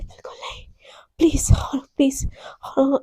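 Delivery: whispered, pleading softly; breathless whisper. Acted. Girlfriend pleading to her partner in a whisper. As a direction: beg in a hush, close and intimate.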